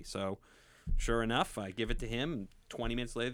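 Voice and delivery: usual speaking voice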